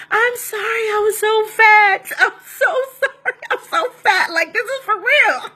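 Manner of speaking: high, weepy sorry with a laugh breaking in; mock sobbing apology, half laughing; imitating crying apology. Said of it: "And I'm crying, apologizing".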